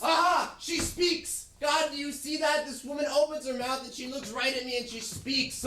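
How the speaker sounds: high loud gleeful crow; husband cheering that his wife spoke; triumphant exclaiming. Acted. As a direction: celebrate her speaking.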